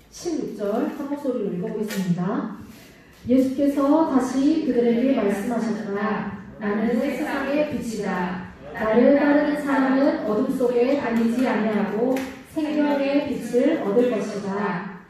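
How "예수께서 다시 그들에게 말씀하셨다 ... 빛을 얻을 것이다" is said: scripture reading. Acted. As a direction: read each word out together with the children, measured and clear. Reads scripture in unison.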